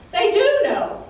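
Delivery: jumps high and loud on the reveal; sudden bright burst of knowing; excited realization. A narrator's reading. Storyteller to children, revealing the disciples now know, excited.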